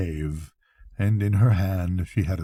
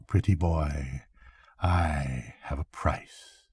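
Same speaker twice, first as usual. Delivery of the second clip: quiet; 5 semitones lower, 7 dB quieter, slower than usual. A narrator's reading. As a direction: drop to a soft, slow murmur. Witch teasing, trailing off.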